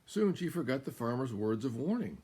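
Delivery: usual speaking voice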